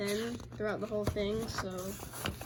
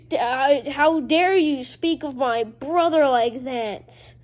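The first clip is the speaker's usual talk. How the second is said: louder, slower hammed-up outrage; mock indignation; 3 semitones higher, 8 dB louder, slower than usual. [name] confronting Bullman about his brother, loud mock outrage. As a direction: demand it like an offended cartoon brother.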